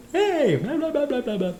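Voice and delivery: very high blah-blah chatter; silly high blabber. Retold or imitated. Driver babbles in a silly voice in his car, very high. Rambling in fun voices while driving.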